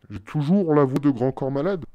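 tongue-in-cheek impression of a slam poet. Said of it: "Je rigole".

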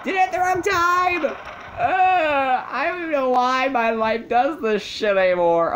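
very high, loud cry of despair; exasperated wail. Acted. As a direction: wail at the top of your range, hammed-up. Player wails after mistimed action.

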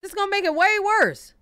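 high, loud, emphatic correction; loud exclaiming. Real, not acted. Friend loudly joking it will make things worse. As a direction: call it out loudly.

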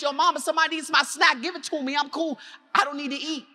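put-on a little boy bargaining to stay; pleading child. Small boy pleading with his mom, lightly voiced child.

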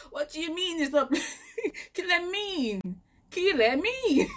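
high, laughing, incredulous; laughing disbelief. Retold or imitated. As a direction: laugh through the question in disbelief.

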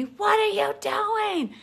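high, loud, drawn-out mock scream; screamed demand. Angry mother to praying daughter, screamed. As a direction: imitate a mother screaming at her child. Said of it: "and she screamed at me".

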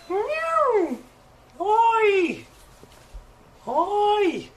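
very high mewing and cooing; high-pitched kitty noises; cat calls. Pretend. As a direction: squeak out drawn calls to the cat.

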